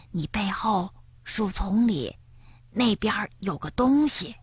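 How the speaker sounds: soft frightened whisper. Acted. Frog warning Bengbeng about the bushes, whispered. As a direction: whisper it so nothing hears. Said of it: "跳跳悄悄地说".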